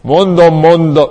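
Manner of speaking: loud, ringing pulpit boom; mock-grand sermon bellow; booming preacher voice. Shown as a demonstration. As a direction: put on an artificial thundering tone. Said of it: "ne tegyél hozzá mesterségesen egy ilyen zengzetes hangzást".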